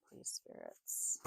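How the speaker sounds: hushed, slow plea to the spirits; whispered plea. Real, not acted. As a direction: breathe the words slowly to the spirits. Pleading with the spirits.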